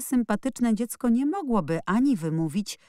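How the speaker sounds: ordinary delivery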